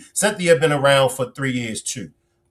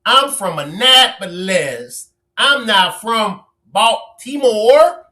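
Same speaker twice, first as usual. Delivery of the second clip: slow, raised mock quote of a proud claim; mocking Big Mama; 5 semitones higher, 4 dB louder, slower than usual. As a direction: drag it out, teasing her pride. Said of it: "Big Mama, you know, feels like".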